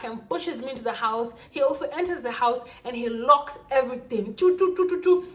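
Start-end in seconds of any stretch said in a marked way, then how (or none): 4.3-5.3 quick high, loud "choo" bursts; imitated lock noises. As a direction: mimic locks shutting one after another. Imitates the locks being shut.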